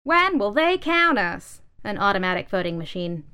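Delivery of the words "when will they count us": much higher, loud, slow question; demanding voice. Acted. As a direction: voice demanding to be counted, high and loud. Voting machine asks.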